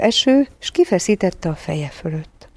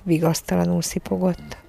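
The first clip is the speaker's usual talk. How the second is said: usual speaking voice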